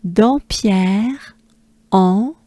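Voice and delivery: Dampierre, pause, nasal "en", both rising; French pronunciation. Shown as a demonstration. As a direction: model "Dampierre, en" for repetition. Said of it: "Let's do it together ensemble".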